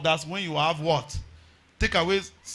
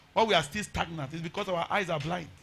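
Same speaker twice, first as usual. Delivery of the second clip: ordinary talk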